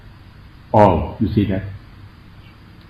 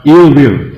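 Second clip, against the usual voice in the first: ill will repeated louder for a student; clear repeat; 3 semitones higher, 5 dB louder than usual. Shown as a demonstration. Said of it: "The second one".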